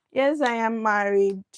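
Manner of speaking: normal talking voice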